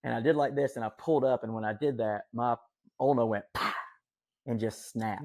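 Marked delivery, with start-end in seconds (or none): 3.5-3.7 sudden popped "pah" sound effect; imitated snap. Telling how his ulna broke, imitated snap. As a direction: imitate a bone popping.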